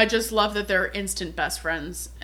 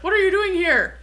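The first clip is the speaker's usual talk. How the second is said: startled outburst, pitched high; a caught intruder snapped at, loud and high; 10 semitones higher, 6 dB louder than usual. Retold or imitated. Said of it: "he's like".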